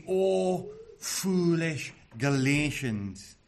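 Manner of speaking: very slow, word-by-word exclamation; slow, emphatic cry of astonishment; astonished cry. Acted. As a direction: draw out each word in disbelief. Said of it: "this cry of astonishment with it".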